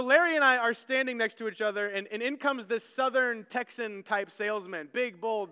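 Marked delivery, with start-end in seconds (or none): none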